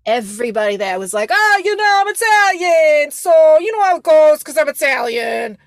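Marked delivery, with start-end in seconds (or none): 1.3-5.6 loud, high Staten Island impression; hammed-up Staten Island brag, pitched way up; mock a loud Staten Island Italian-American; Staten Island accent. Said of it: "when I was on Staten Island".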